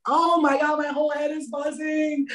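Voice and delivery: a touch of a cry as the head buzzes; overwhelmed exclaim. Retold or imitated. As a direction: act out being startled by the buzzing. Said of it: "It'll be like".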